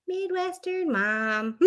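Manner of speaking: put-on slow, drawn-out Midwestern accent; Midwestern mom voice. Host presenting her puffy hair to co-host in Midwestern accent. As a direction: show off the haircut in a Midwestern twang.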